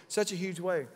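ordinary talk, nothing marked

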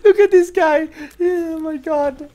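high-pitched laughing fit, words squeezed out; laughing hard. Real, not acted. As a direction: barely get the words out for laughing. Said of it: "Why am I laughing so hard at this?".

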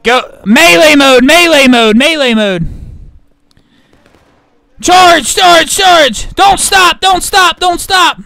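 loud high charging shouts; battle cry. Real, not acted. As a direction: yell the charge at full force.

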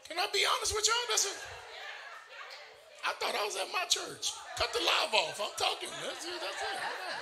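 high, laughing chatter; giggling aside to the crowd. Real, not acted. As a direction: break off laughing mid-thought. Laughing aside mid-sermon.

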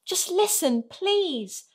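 put-on high, earnest child's plea; pleading. A narrator's reading. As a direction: appeal to your parents to hear you out. Sarah pleads with frightened parents.

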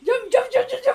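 loud, high repeated shout; urgent shout. Real, not acted. Player urging a teammate to jump, urgent shouting. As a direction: yell it urgently, over and over.

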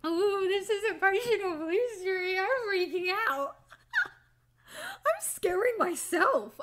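high, wavering sing-song panic; mock freak-out. Real, not acted. Voice actor scared by own blue streak, mock freak-out. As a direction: wail it high, half laughing at yourself. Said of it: "I'm going into big emotional blue streak".

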